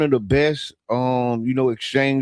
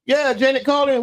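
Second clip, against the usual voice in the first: calling out; 11 semitones higher than usual. Real, not acted. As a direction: call her in on the line. Calling out to Janet on air.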